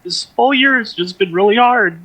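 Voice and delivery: high, choked-up voice; fighting back tears. Real, not acted. Host on a hard year, tearfully. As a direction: get through it while crying. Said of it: "I'm trying not to cry".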